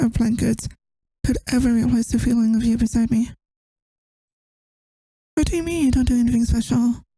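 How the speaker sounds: normal talking voice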